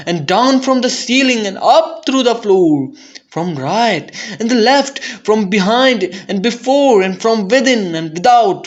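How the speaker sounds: mounting frenzy. A narrator's reading. Narrator racing as the rats pour in from everywhere. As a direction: pile it up like a flood of rats.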